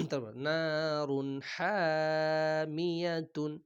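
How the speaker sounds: slow chanted নারুন হামিয়াতুন; drawn-out recitation. Shown as a demonstration. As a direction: stretch নারুন হামিয়াতুন like tilawat. Quran reading drawn out.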